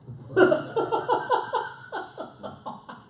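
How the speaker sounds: airy, high-pitched burst of laughter; high breathless laugh. Real, not acted. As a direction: laugh in delighted disbelief. Laughs at daughter's news.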